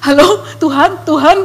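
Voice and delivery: tentative rising call to God; naive, innocent. Retold or imitated. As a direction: call out as if unsure anyone answers. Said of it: "masih polos ya kan".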